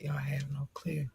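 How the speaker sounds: hushed, unvoiced confession; overcome whisper. Real, not acted. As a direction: whisper it, melting with emotion. Overcome by listeners' love.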